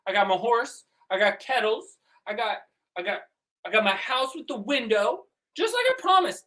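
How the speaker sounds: high, fast bragging voice; proud boasting. Acted. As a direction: show off each prize.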